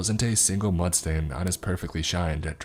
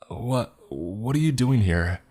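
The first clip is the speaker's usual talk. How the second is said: slow, higher, stammered question; stammering; 5 semitones higher, slower than usual. A narrator's reading. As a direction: stumble over the words in fright. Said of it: "I stammered".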